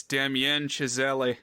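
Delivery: Damien Chazelle as dam-YEN shi-ZELL-ee; playfully over-foreign Damien Chazelle, extra EE ending; mock foreign pronunciation. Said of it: "Maybe it's".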